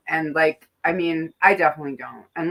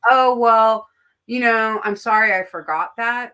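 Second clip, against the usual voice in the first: fake apology; 4 semitones higher, 7 dB louder than usual.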